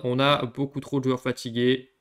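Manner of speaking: ordinary delivery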